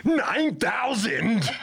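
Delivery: very high, drawn-out hammy shout; cartoonish battle yell of disbelief; mock astonished yell. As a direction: stretch it into an over-the-top cry. Mimicking scouter power reading punchline.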